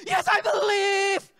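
slow, very high and loud "Yes, I believe"; full-throated shout. Retold or imitated. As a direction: roar it out in downtown Seattle. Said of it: "I can't hear you".

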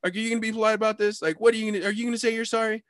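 high, quick pestering questions; insistent arguing. Retold or imitated. Teller demands an apology for a shove, insistently. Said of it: "I just kept saying".

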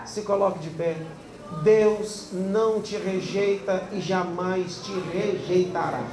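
slow it down and let it land; solemn, slow and soft. Acted. Pastor tells the congregation to stand, slow and solemn.

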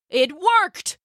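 high-pitched triumphant exclamation; excited high cry; thrilled. Acted. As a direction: burst out, delighted it succeeded. Pot doubles the blanket.

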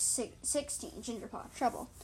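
ordinary delivery, nothing marked

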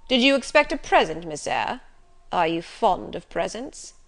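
gruff master, loud questioning. A narrator's reading. Master quizzes the governess about presents, gruffly.

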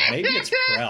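squeaky high laughing. Real, not acted. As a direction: crack up mid-word. Laughing at own dumb memory.

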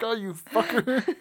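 slow, high exasperated grumble; grumbling old boss. Retold or imitated. Imitating Regal annoyed at Grimes.

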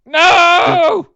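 loud, high shout of protest; a big hammed-up no; mock despair. Real, not acted. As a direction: wail it at the frozen screen. Guest's connection drops mid-story.

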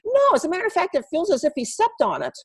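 high, light imitation of the surprised student; student's voice. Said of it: "And she goes".

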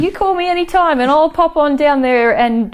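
high, bright Aussie helper; eager Aussie handyman promising help; aussie tradesman. Retold or imitated. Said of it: "if I wanted to feel like".

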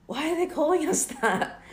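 high-pitched retold bewilderment; mock-shocked, voice jumping way up; hurt, high-voiced disbelief, replayed; shook. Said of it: "I was shook".

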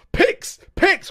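high, loud demand for pics; excited shout. Real, not acted. Eager shout.

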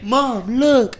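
high, loud mock announcement; mock reveal. Friend acting out showing mom the tattoo, high and loud. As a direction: shout it out like showing off.

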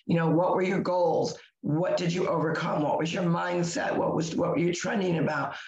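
ordinary delivery

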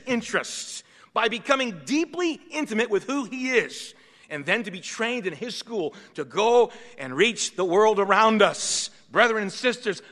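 preaching at full force. Acted. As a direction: preach it out to the congregation.

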